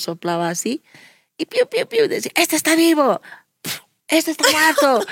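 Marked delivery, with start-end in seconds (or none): 1.5-2.1 very high little chirps; squeaky mimed cheeps; imitated chick cheeping. 2.4-5.1 high-pitched sorting calls; retold high childlike voice; imitated excited child. Acts out children sorting chicks.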